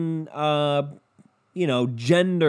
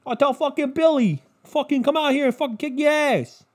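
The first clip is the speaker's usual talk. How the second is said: New York pizza guy; 10 semitones higher than usual. Retold or imitated. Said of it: "we're not New York".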